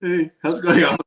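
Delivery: high, loud comic call to the group; mock lonely outcast. Left-out kid calling to huddled friends, high mock hail. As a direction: call out hopefully from the sidelines. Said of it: "John just sitting there like".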